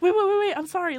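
high, loud panicky backpedal; frantic apology. Retold or imitated. Caller to partner who is hanging up, frantic apology. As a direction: blurt it out, pleading to stop them. Retold hurried apology after a hang-up.

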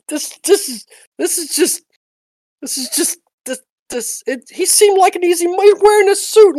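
high, fast, frantic pleading; panicked whining. Acted. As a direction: whine your excuses in a panic. GM voices captured mugger pleading.

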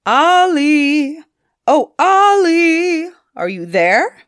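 loud, high call over water; troll calling far across the water; hallooing across the bay. A narrator's reading. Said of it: "hallooed across the bay".

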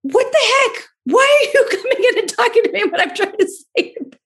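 put-on annoyed toddler voice. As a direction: play the grumpy toddler, high and indignant, with a laugh. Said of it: "'Cause he was like".

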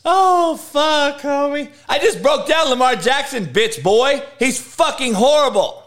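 high, loud gloating taunts; high excited gloating. Real, not acted. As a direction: crow over the viewer's hurt feelings. Gloating at a viewer.

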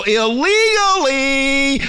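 "illegally" stretched into a rising holler; Oprah Winfrey. Pretend. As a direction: draw out the end of "illegally" like an announcer. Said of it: "It's stupid Oprah Winfrey".

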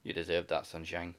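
a hint of a stern dad teasing "sunshine"; disapproving dad. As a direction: talk down to him like a disappointed dad. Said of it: "Just like the disapproving dad".

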